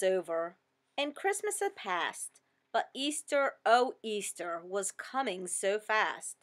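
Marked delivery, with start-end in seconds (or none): none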